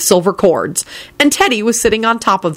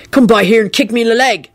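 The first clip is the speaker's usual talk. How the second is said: higher-pitched, grumbling robber; dark; 4 semitones higher than usual. A narrator's reading. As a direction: add it with dark resentment. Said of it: "he added darkly".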